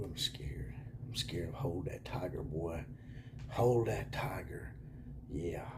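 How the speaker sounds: sarcastic fearful whisper; mock scared whisper. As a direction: whisper like you're pretending to tremble.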